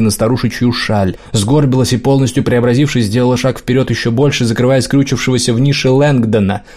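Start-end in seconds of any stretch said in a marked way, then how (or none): none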